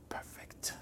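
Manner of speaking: slow whispered secret; hushed slow revelation. Real, not acted. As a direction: lean in and whisper it slowly.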